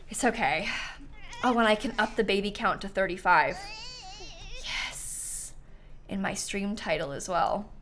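ordinary delivery

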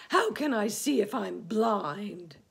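loud, high exasperated shout; indignant earthworm yell. Acted. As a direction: shout back in outrage, drawing out the words. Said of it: "shouted the earthworm".

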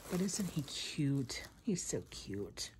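hushed whisper, slow and soft. Real, not acted. Showing viewers her new popcorn plush, a soft whisper. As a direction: whisper softly over the cute toy.

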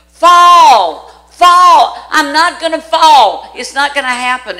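panicked yell. Retold or imitated. Wife refusing to let go on the mountain, shouting high. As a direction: scream it while clinging on. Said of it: "George kept saying".

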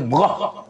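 sudden "brah" burst; short rough noise effect; imitated crash.

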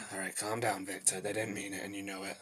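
very soft, low talking to yourself; calm yourself down under your breath; hushed private pep talk; self-soothing. Acted. Talks himself down, alone.

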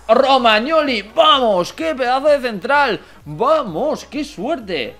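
loud, high-pitched jubilant shouting; excited celebration. Real, not acted. Gamer celebrates signing the centre-back he wanted, excited shout. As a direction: shout it out in sudden delight.

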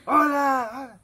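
high, loud call fading like an echo; calling into a cave. Retold or imitated. A gangoso calling into a cave, loud call. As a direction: shout hello into a cave. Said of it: "dije que".

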